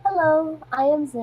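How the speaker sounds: eager greeting. Acted.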